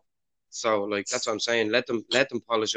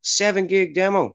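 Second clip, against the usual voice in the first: shocked disbelief; 6 semitones higher than usual. Real, not acted. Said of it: "Fucking hell".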